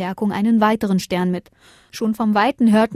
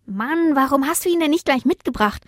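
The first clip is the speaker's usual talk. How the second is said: high, brisk little star voice; irritated high-pitched question; annoyed; 6 semitones higher than usual. A narrator's reading. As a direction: ask it crossly, quick and high. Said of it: "fragten die anderen Sterne ärgerlich".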